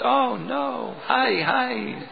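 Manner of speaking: high, soft wail of dismay with a sob; crying out. Acted. Brahmin finding the offering eaten again, high soft wail. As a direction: lament as if near tears. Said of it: "the Brahmin cried out".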